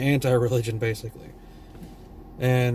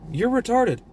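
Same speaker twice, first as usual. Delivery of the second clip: jumps way higher on the punchline, scornful; mocking jab; 9 semitones higher than usual. Real, not acted. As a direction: spit it out with a high mocking sneer.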